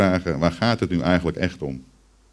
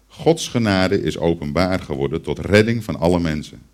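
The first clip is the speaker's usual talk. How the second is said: ordinary talk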